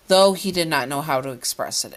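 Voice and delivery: ordinary delivery, nothing marked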